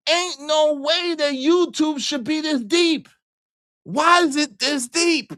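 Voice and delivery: high, slow, whining put-on voice; whiny mock complaint. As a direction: whine it like a wounded victim, drawn out.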